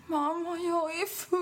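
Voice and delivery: put-on high, drunken wail; drunk self on voicemail. Hearing her drunk voicemail to mom, high wail. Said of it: "hör jag mig själv".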